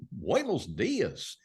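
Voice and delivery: "Buenos dias" drawn out very slowly with Goofy's scoops and valleys. Shown as a demonstration. Showing how Goofy sounds in Spanish, slow scooping delivery. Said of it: "Whereas if Goofy".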